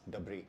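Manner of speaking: डबरी as duh-bree, no s; correct pronunciation. Shown as a demonstration. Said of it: "I've been pronouncing it as".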